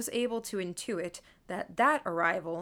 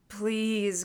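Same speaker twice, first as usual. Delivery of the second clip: pained, aching please; agonized plea; 2 semitones higher than usual. Acted. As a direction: plead as if giving up. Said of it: "in an agonized way".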